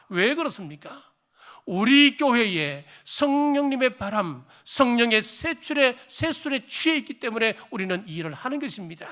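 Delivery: loud, soaring sermon delivery; fervent preaching. Acted. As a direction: preach it out to the whole congregation. Pastor preaching at full force.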